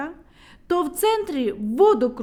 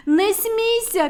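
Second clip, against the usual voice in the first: high, loud, slow rebuke; sharp shout; 11 semitones higher, 7 dB louder, slower than usual. A narrator's reading. Medunytsia shouts at Neznaiko to stop laughing. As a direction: snap at him to stop laughing. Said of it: "крикнула медуниця".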